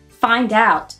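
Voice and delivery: ordinary delivery, nothing marked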